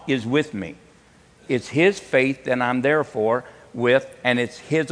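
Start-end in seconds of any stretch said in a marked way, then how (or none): none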